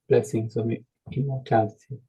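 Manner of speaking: ordinary delivery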